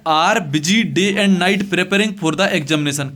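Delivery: very slow, each word set apart; slow dictation. Shown as a demonstration. Teacher reading the letter line to students, very slow. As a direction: dictate it so they can copy it. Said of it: "teacher dictating letter slowly".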